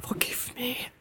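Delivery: frightened whisper. Acted. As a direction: plead in a whisper.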